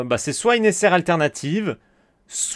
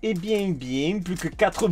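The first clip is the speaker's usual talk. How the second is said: a light put-on southern French accent, singsong; 2 semitones higher than usual. Collector grumbling at bad pulls in a mock southern French accent. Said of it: "Quand je commence à prendre l'accent du sud".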